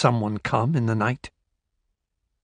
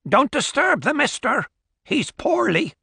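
put-on high curt old crone; brusque old countrywoman, higher pitch; old woman voice; 13 semitones higher than usual. Old woman warns guest.

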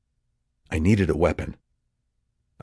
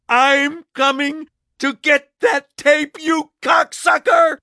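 high, slow, screaming fury; shrieking rage; 5 dB louder, slower than usual. Acted. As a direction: shriek it like a war cry. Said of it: "voice like an erupting volcano".

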